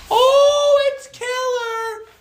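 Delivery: very high, slow ecstatic shout; hype shout. Pretend. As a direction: belt it out like an overexcited fan. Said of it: "Sorry, I had to say that".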